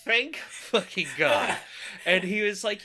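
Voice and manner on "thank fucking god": slow, drawn-out words through laughter; real laughter breaking into the words; laughing relief. Real, not acted. Laughing relief after telling the faint.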